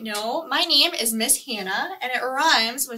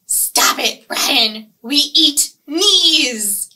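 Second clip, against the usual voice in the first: angry piranha; 2 semitones higher, 7 dB louder, much slower than usual. Acted. Piranha scolding fruit-loving Brian, loud snarl. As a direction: snap at Brian like a hungry fish. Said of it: "my piranhas here".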